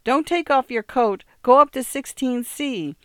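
high, loud panicked plea; wife frantic in the hallway, urging her husband. A narrator's reading. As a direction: push him back out the door in a panic. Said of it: "she screamed".

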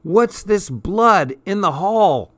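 suspicious shout. A narrator's reading. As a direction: sister yelling down the hall. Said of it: "I heard Betsy shout".

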